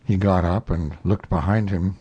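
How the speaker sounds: plain reading voice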